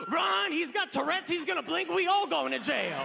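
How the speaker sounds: high-pitched panicked cry to run; freaked-out friend sounding the alarm. Acted. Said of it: "He panicked. He lost it".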